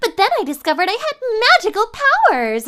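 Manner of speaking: high, bright anime schoolgirl voice; put-on schoolgirl voice. Shown as a demonstration. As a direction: chirp it like an anime heroine. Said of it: "the anime schoolgirl voice".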